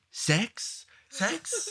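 put-on teasing kids, rising up high, laughing; mock kids whispering. Telling parents how older kids teased, mock high teasing. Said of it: "be like".

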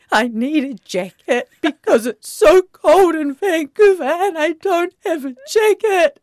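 high, loud, slow crying wail; retold crying. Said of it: "I kind of started crying".

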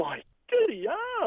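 high, slow gasp of mock astonishment; hammed-up amazement. Host hears of the inheritance, hammed-up astonishment. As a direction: overplay the shock at the fortune.

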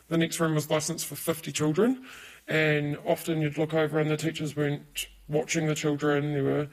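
ordinary talk, nothing marked

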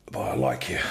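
dropped low pitch; low-voiced mirror talk; acted self-reassurance. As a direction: drop right down to reassure yourself. Softened answer in mirror talk.

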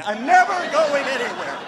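laughing mock delight; laughing vow. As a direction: giggle while you swear to stay.

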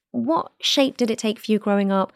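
normal talking voice

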